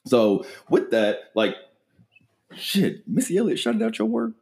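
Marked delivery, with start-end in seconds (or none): 2.5-4.3 high-pitched, awestruck disbelief; hyped, incredulous praise; amazed. Real, not acted. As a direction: squeal it in sheer amazement. Hyped amazement at guest's shout-out.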